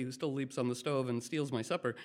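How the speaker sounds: ordinary talk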